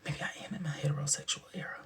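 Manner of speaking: soft, fast, unvoiced mock revelation; whispered realization. Questioning herself about a character, in a whisper. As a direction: breathe it like a shocking secret. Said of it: "I said".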